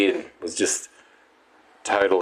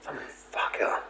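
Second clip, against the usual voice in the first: whispered, fed up; a hushed swear at the noise; whispered curse; 11 dB quieter than usual. Retold or imitated. Cursing the upstairs shower in a whisper. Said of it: "I was sitting there going".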